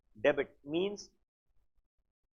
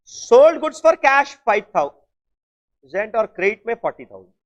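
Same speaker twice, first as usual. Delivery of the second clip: very slow, louder dictation; teacher dictating the next transaction; 7 dB louder, much slower than usual. Shown as a demonstration. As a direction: dictate each word for them to write. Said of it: "dictating transaction slowly".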